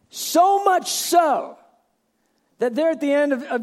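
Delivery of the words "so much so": so much so, called out high and slow; preaching call-out, high and slow; shouted emphasis. Real, not acted. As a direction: lift it up and drag it out for the room. Preacher builds to a point.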